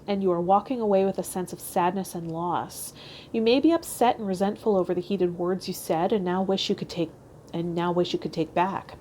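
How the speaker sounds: usual speaking voice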